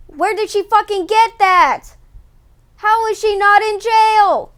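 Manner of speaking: loud, high, incredulous shouting; outraged disbelief. Real, not acted. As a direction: yell it like you can't believe it. Outrage again over stolen money.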